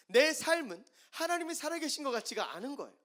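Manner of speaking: high, loud, anguished preaching voice; impassioned preaching. Acted. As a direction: cry it out from the pulpit. Preaching at full force. (절규하듯 높고 크게 외치는 설교)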